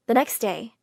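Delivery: ordinary delivery, nothing marked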